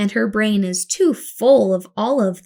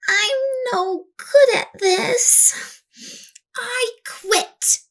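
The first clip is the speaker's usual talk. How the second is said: put-on much higher, slow, sulky little girl; defeated girl; 12 semitones higher, slower than usual. Girl complains to her assistant, whiny and sulky. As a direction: give up with a whiny pout.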